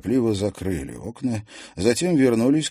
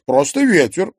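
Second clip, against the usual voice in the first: ordinary delivery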